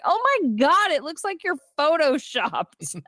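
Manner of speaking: high-pitched awestruck exclamation, drawn out slowly at the end; mock amazement. Woman sees her date shirtless, hammed-up amazement. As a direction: act out being floored by the sight.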